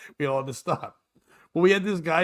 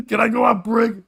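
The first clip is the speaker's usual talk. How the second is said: loud, higher mock Russian coworker; blurted out loud; 6 semitones higher, 6 dB louder than usual. Coworker asking for break mid-silence, blurted out loud. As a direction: imitate him blurting over the hush. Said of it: "just out loud goes".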